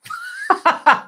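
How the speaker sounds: very high-pitched burst of laughter; real, squealing laugh. Real, not acted. Laughs after egging viewers on.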